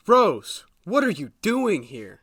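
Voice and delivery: loud, high cry of alarm; startled cry on seeing Rose arrive; shocked. Acted. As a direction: jolt at the sight of her.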